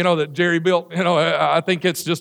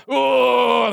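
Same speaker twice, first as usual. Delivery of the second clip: mock groan; 4 semitones higher than usual. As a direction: groan through the loop. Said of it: "it's such a groan".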